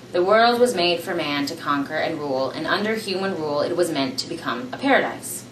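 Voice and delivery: ordinary delivery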